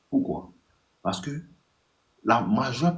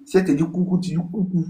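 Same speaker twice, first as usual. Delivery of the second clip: light girly voicing; 8 semitones higher, faster than usual. Repeat of rule, higher.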